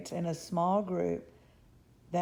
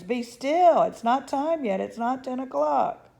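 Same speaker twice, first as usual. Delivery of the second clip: louder, higher stern call; crying out; 7 semitones higher, 8 dB louder than usual. A narrator's reading. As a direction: scold the eager kids. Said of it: "cried the fathers".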